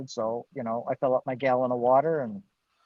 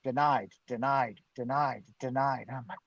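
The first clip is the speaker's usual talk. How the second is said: ordinary talk, nothing marked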